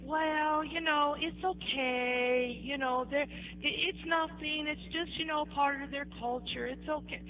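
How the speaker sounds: soft, hesitant excuse-making; imitated placating. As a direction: play the sheikh smoothing it over softly. Sheikh placating her, making excuses.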